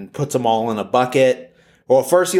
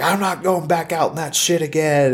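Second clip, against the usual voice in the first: mock-griping cowboy, pitched higher; fed-up frozen man; 7 semitones higher than usual. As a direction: grumble it like a half-frozen guy stomping in. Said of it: "says, like".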